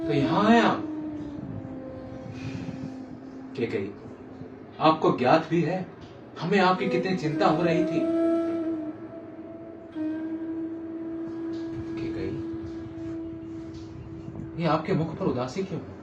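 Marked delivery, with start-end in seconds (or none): 3.6-3.9 low, soft naming; gentle concern. Acted. King finding his sulking queen, gentle concern. 11.9-12.3 low, soft naming; gentle concern. Acted. King finding his sulking queen, gentle concern.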